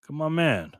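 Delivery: ordinary talk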